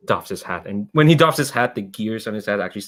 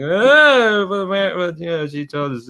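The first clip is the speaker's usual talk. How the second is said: high, pleading crowd voice; a crowd of kids protesting, pitched up; lightly voiced crowd of students protesting; 9 semitones higher, 5 dB louder, slower than usual. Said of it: "a crowd of people like".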